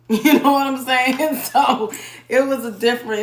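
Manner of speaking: laughing through the words. Real, not acted. Vlogger laughing at her own Atlanta story. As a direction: chuckle it out, amused.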